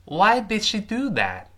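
measured demonstration, lifted pitch; slow model reading. Shown as a demonstration. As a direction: read it out slowly for learners to copy. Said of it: "我们完整的读一下这句话".

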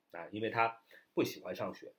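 normal talking voice

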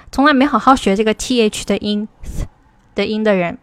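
ordinary delivery

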